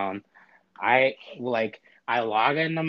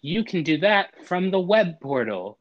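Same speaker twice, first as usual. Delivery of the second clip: high, bright mock robot lady, louder; chirpy robot lady; 7 semitones higher, 4 dB louder than usual. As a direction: cheerfully brush him off. Robot lady refuses cancellation.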